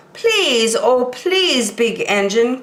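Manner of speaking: high, begging toy voices; high little-toy plea; dolls and toys pleading. Acted. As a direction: plead sweetly like a chorus of dolls. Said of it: "cried all the dolls and toys together".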